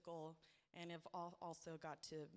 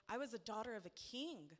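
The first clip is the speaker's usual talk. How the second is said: louder, higher, rising testimony fervour; impassioned preaching; 5 semitones higher, 5 dB louder than usual. Real, not acted. Daughter of a king declaring God's promises, impassioned preaching. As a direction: build it up and proclaim each promise.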